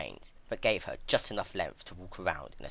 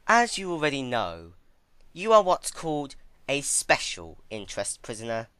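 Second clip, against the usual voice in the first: higher, louder, coldly smug announcement; smug villainess; 7 semitones higher, 7 dB louder than usual. Acted. Twilight informing Celestia she is a prisoner, smug and higher. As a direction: lay down the rules like a gloating jailer.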